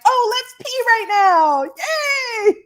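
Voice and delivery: squealing high, laughing excited bladder; gleeful bladder voice. Retold or imitated. Her bladder cheering during the throat swab, high and gleeful. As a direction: cheer like a bladder thrilled to let go. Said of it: "And my bladder was like".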